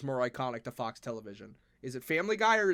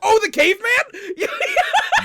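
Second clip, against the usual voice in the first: high squeal of laughter; laughing; 8 dB louder, slower than usual. Real, not acted. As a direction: laugh through the words. Laughing at the caveman idea.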